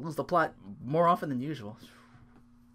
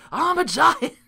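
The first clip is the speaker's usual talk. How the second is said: high, loud goofy "I'm a giant"; mock giant; 14 semitones higher, 8 dB louder, slower than usual. Imitating co-host's giant line, high hammed-up boast. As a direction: ham up the giant brag. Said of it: "I remember P M bringing it up".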